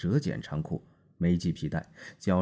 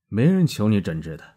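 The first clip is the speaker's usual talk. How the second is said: louder, higher, snapping back at the doctor; wife snapping back at the cold doctor; sharp retort; 4 semitones higher, 11 dB louder than usual. A narrator's reading. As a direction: cut him off with open anger. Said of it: "我说".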